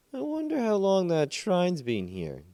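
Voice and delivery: a curious villager wondering aloud. Acted. Villager figure voiced.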